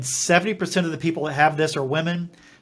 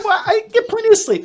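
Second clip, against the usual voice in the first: much higher, louder squeaky protest; mock defensive denial; 6 dB louder than usual. To the doctor, insisting he sleeps enough, in a squeaky mock protest. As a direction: play it as his own overconfident denial, pitched up.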